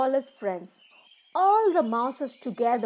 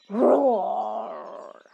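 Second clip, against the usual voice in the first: loud lion roar; 5 dB louder than usual. Acted. Narrator roaring as the woken lion.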